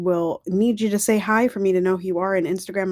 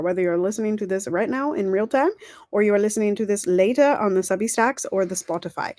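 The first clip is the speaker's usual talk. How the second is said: ordinary talk, nothing marked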